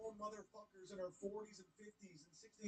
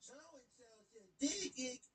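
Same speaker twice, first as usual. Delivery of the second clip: mocking tattler; 5 semitones higher, much slower than usual.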